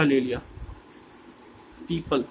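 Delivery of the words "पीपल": usual speaking voice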